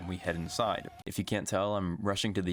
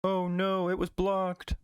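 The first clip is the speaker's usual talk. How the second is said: higher, hammed-up cartoon whine; mock dismay; 9 semitones higher, slower than usual. Pokemon whining that its attack got blocked, higher mock dismay. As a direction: whine like a defeated cartoon monster.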